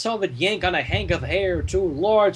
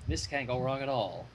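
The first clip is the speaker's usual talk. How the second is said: ordinary delivery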